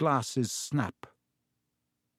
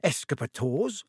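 higher, curious lift; eager; 5 semitones higher than usual. A narrator's reading. Wizard father asking about the Underground, eager. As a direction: ask with keen curiosity. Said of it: "said Mister Weasley eagerly".

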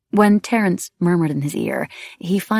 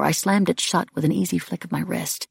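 ordinary delivery, nothing marked

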